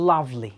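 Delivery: Lovely with the beat on লাভ, then falling away; first-syllable stress in lovely. Shown as a demonstration. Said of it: "first syllable হয়েছে". (লাভলি-র প্রথম সিলেবলে জোর)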